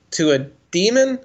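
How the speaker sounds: ordinary talk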